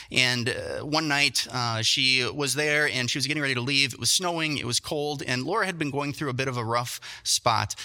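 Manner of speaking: ordinary delivery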